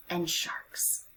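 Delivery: soft whisper; mysterious deep sea hush; a quiet awed whisper. A narrator's reading.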